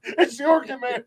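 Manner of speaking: very high comic squeal of alarm; hammed-up, high-pitched fright; mock panic. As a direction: warn your buddy as a terrified mosquito. Mosquitoes panic at the Orkin logo.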